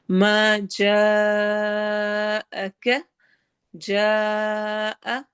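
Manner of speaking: slow chanted tajweed with a long drawn ha; Quranic recitation. Shown as a demonstration. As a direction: recite it, stretching the vowel. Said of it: "مد متصل ہے".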